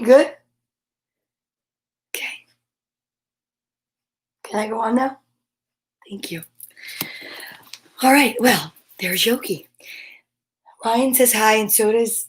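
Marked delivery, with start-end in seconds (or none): 6.1-6.4 soft, low whispered thank you. Real, not acted. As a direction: murmur it gently.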